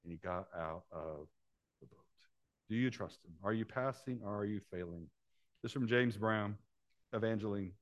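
normal talking voice

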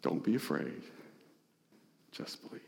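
almost whispered, tender comfort; whispered reassurance. Acted. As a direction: breathe it to him like a secret promise. Jesus repeats the comfort.

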